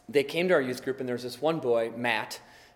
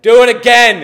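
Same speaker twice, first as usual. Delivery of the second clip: loud, high retold command; mock-thundering voice from above; insistent shout; 9 semitones higher, 20 dB louder than usual. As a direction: boom the order out, brooking no refusal. Retold prompting repeated, now insistent.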